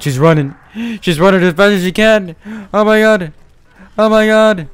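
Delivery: very loud, high-pitched screaming commentary; frantic shouting. Pretend. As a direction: scream it, stretching the last cry out long. Frantic reaction to the chase.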